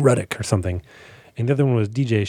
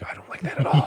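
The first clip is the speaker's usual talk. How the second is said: whispered; 5 dB quieter than usual. Retold or imitated. Overstuffed inner groan.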